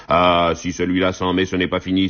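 plain reading voice

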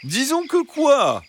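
very high, slow, sweet fox voice; insistent fox. A narrator's reading. Fox presses the hens to finish, insistent. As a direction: press them in a high, drawn-out voice. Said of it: "insista le renard".

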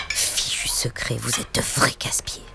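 mother grumbling to herself while clearing the table, whispered; muttered whisper. Acted. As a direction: grumble it away in a hushed whisper. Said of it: "en marmonnant".

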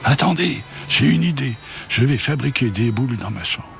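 excited cry. Acted. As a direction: burst out with a bright idea. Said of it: "Rosalie s'écria".

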